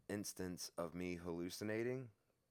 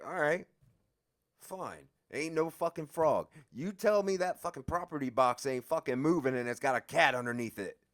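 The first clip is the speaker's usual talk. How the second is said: louder, higher, heated insistence; defiant rant; 9 semitones higher, 11 dB louder than usual. Retold or imitated. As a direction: throw it back at the guard, furious.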